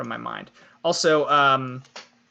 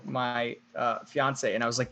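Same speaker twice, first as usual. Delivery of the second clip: normal talking voice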